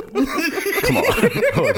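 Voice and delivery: high laughing come on. Real, not acted. Friend laughing at memories of Brazilian girls, high laughing. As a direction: laugh it out in disbelief.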